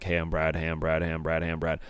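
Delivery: low, soft, repetitive mumble; awkward self-introduction. Retold or imitated. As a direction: imitate yourself introducing yourself on a quiet loop. Awkward repeated self-introduction to strangers.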